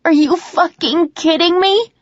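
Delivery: high, loud, slow furious scream. Acted. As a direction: scream it even harder, stretching it out. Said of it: "I scream even louder".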